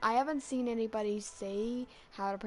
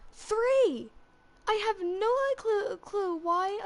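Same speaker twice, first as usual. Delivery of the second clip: loud, high cry of disbelief; incredulous exclamation; 9 semitones higher, 5 dB louder, slower than usual. Real, not acted. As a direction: burst out in baffled amazement. Baffled at three Harbingers appearing.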